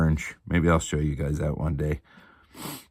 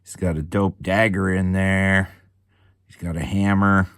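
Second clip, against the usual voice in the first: ordinary talk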